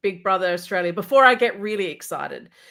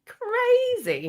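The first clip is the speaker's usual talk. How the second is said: mock amazement. Echoing host's crazy, mock amazed.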